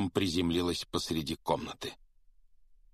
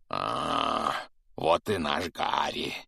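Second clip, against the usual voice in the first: satisfied giant; 3 semitones lower, much slower than usual. A narrator's reading. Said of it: "удовлетворённо произнёс великан".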